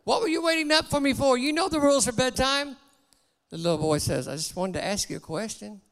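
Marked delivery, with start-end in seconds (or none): 0.0-2.8 much higher, stern, mildly yelling father; father yells at his son about bedtime; mock scream. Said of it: "he screams at the boy". 4.3-5.8 put-on much softer, small child's reply; little boy answers his father meekly; timid boy.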